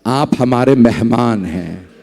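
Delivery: gentle smiling reply. Acted. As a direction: answer him kindly with a sad smile. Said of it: "मौला ने मुस्कुरा के कहा". (धीमी, नरम, मुस्कुराती आवाज़)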